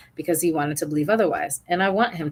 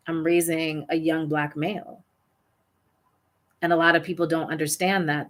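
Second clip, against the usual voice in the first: normal talking voice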